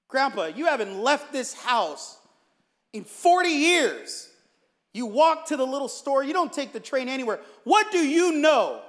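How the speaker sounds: exasperated yelling. Acted. Grandson challenging grandpa's advice on trains, yelling. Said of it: "I'll let you yell all you want".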